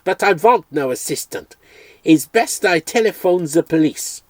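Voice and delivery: a hammed-up German vet raging at an intruder; corny German accent. Dream vet threatening to phone police, furious corny German accent. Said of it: "such a completely corny accent".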